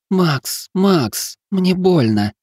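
high, pained moaning plea; a sick wife moaning her husband's name. Acted. Sick wife moans to her husband Max in pain. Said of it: "простонала женщина".

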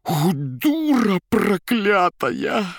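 hoarse, high, slow furious rasp. Acted. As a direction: wheeze out a curse in rage. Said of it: "хрипя".

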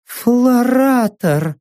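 choked, gurgling and drawn out; strangled gurgle. Acted. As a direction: gurgle the name through a tight throat. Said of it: "сдавленно пробулькала Сака".

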